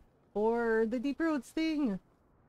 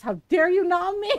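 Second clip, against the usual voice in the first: mock indignation; 8 semitones higher, 7 dB louder than usual. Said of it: "And Ron is like".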